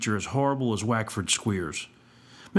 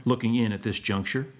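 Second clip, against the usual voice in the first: plain reading voice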